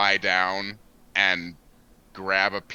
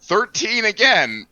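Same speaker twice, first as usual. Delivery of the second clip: exasperated disbelief; 11 semitones higher, 5 dB louder, slower than usual. Real, not acted. Rolling same bad number.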